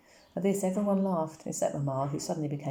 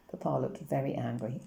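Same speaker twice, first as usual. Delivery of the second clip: usual speaking voice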